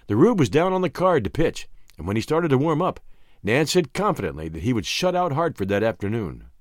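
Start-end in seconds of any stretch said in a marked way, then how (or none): none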